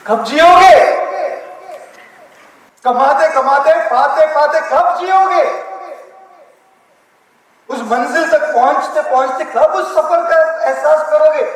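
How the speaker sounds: loud and urgent, pushing the crowd; rousing shout. Real, not acted. Speaker rallying a hall full of listeners, loud and urgent. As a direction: hammer each question loud and fast.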